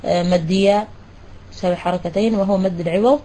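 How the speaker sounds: ordinary talk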